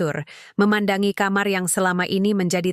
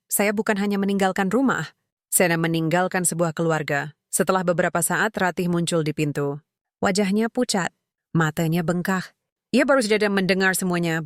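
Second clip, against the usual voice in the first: ordinary delivery, nothing marked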